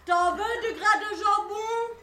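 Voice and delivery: loud, high, drawn-out sing-song taunt; mother's crude taunt. Retold or imitated. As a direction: bellow a slow mocking chant, stretching each phrase. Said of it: "elle n'y allait pas dans la finesse". (raillerie brutale, chantante et criarde)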